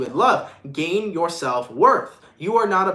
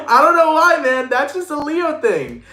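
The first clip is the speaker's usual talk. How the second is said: amused; 10 semitones higher, 6 dB louder than usual. Real, not acted. Amused after Leo impression.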